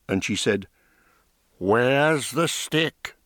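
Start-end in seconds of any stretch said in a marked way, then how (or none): 1.6-3.2 slow, higher crone voice; old woman voice. Acted.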